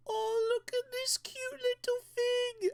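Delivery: put-on very high baby-talk coo; gushing over cuteness. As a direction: squeal adoringly at a tiny pet. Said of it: "seems like a Goliath versus Ant".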